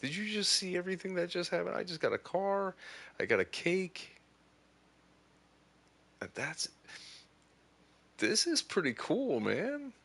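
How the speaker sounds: high, fast, thrilled recap; real breathless delight; giddy excitement. Real, not acted. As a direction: rattle it off, buzzing with excitement. Telling viewers about the surprise.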